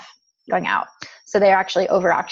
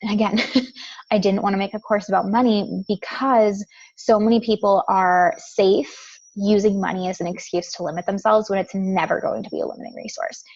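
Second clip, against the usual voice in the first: ordinary delivery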